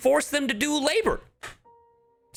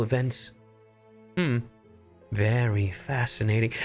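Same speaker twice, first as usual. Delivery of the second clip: musing detective, measured and deliberate; Temenos. Acted. Streamer imitates the inquisitor in detective mode, musing voice. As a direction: ponder each clue with long pauses. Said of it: "this motherfucker's like".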